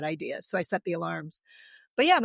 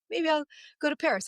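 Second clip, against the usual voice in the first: playful daydream; 8 semitones higher than usual. Retold or imitated.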